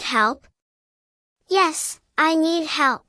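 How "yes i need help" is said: ordinary talk, nothing marked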